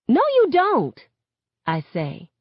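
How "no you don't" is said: high-pitched, forceful denial; upset outburst. A narrator's reading. Daughter rejecting her mother's understanding, upset outburst. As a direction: cut in hard, voice jumping high.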